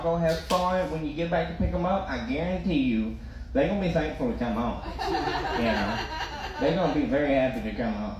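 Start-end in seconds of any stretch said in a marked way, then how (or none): none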